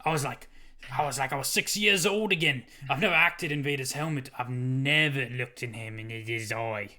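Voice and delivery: put-on Scottish accent; hammed-up Scots impression; Ewan McGregor. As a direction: lay on the Scottish accent for the quote. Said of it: "It took a while to get to the Scottish part of his accent".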